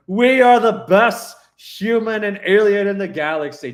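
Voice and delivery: put-on loud, high, sloppy drunken boast; drunk astronaut. Astronaut drunkenly boasting to the alien, loud and high. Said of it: "Just listening to him get drunk".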